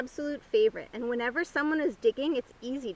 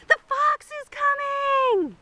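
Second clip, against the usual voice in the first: much higher, loud, slow scream; screaming cat; 8 dB louder, slower than usual. A narrator's reading. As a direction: yell a panicked false alarm. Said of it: "screamed at the top of his lungs".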